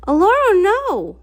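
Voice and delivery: alarmed cry. Acted. Cadance crying out as the sorceress attacks Aloro, loud and high. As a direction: shout no to stop her.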